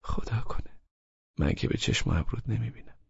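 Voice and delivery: soft murmur to herself, half whispered; under her breath. A narrator's reading. Laleh reading a text, murmuring under her breath. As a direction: say it to herself so he barely hears. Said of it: "زیر لب گفت".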